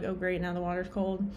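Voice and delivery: ordinary talk, nothing marked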